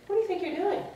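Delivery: high-pitched confrontational question; accusing cousin. Retold or imitated. Cousin confronting her in the hallway, high and accusing. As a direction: demand an explanation, pitched well up.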